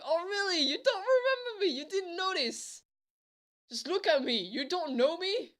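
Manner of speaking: imitated girl, high and incredulous; teasing girl. Girl teasing him for not remembering her, incredulous. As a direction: pitch it up in mock disbelief. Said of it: "she was, like, laughing".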